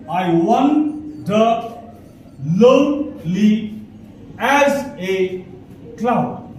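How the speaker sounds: "I wonder lonely as a cloud" very slow, split into two-syllable feet; broken into feet. Shown as a demonstration. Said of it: "it will be something like this".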